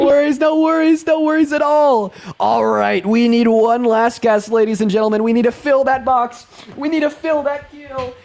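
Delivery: high showman patter; rally the crowd for one last guest; hype announcer. Acted. Host calls for last guest.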